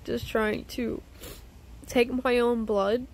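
ordinary talk

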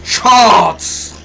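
unhinged yell; psycho scream. Real, not acted. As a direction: scream it like you've snapped. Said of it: "Psikopata bağladım".

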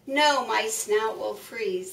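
usual speaking voice

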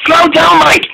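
high, alarmed cry. A narrator's reading. Boko to Mike, crying out in alarm. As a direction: yell it as the train sways. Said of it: "he cried".

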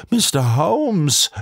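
narrated outcry, stretched on the name; crying out. A narrator's reading. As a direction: call out aghast without real shouting. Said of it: "schrie er auf".

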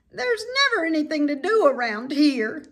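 put-on high, loud whiny young pig; bored pig voice.

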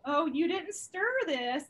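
3 stretch out the words like a knowing hint; 1 suggestive drawl. Retold or imitated. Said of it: "this one girl was like".

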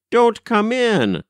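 frantic high-pitched warning; wild. A narrator's reading. Governess at the gate stopping mother, wild. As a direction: cry it out wildly, voice leaping high. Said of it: "said Miss Simpshell wildly".

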